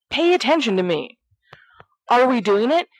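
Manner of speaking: put-on whiny, excited boy pestering his friends; eager pleading. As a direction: beg like an impatient kid who can't wait. Sirius begging the group to agree.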